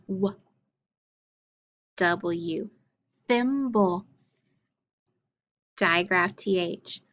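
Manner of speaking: ordinary talk, nothing marked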